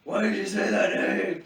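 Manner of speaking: high, loud outburst of a quote; mock dramatic shout. Co-host echoing a movie line, in a mock dramatic shout. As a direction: shout it in over-the-top anguish.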